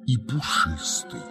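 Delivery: ordinary delivery, nothing marked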